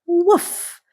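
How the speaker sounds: high yappy little puppy woofs; puppy bark. Acted. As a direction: bark like a tiny excited puppy. Puppy barks in story.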